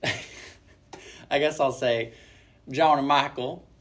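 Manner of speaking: slow, drawn-out Kentucky Southern accent, with a laugh. Shown as a demonstration. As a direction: lay on a Southern Kentucky twang. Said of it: "with a Southern accent".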